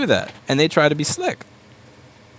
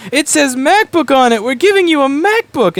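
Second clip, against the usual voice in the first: even higher and louder, faster repeat; a hyped-up high pitch pushing the MacBook again; mock salesy pitch; 12 semitones higher, 7 dB louder than usual. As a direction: push the sell harder. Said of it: "and go".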